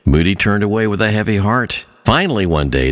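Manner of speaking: usual speaking voice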